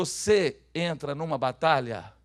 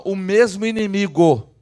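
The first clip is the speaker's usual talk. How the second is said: slow, very loud proclamation; saying it loudly; 3 semitones higher, 14 dB louder, slower than usual. Shown as a demonstration. Preacher has the congregation repeat the line, saying it loudly. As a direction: project it firmly for the crowd to echo. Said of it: "E fala bem alto assim".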